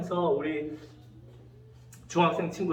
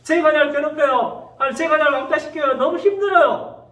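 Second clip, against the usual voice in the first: high, loud acted plea; retold desperate prayer, pitched way up; crying out to God; 7 semitones higher, 5 dB louder than usual. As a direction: plead upward like a bullied child praying. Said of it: "하나님께 부르짖는 친구".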